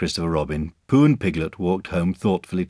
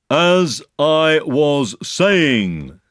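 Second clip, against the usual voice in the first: very slow, higher, insistent; loud and stern; 10 semitones higher, 6 dB louder, much slower than usual. A narrator's reading. Eeyore talking over interruptions, loud and stern. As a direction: reclaim the floor word by word. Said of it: "said Eeyore loudly and sternly".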